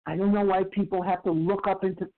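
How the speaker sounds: ordinary talk, nothing marked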